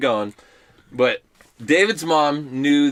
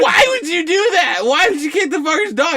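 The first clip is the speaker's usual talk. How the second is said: high incredulous questioning; mock outrage in a high voice; appalled mother; 14 semitones higher, 4 dB louder than usual. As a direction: imitate a horrified mom, voice pitched way up. Mother confronts son in retelling.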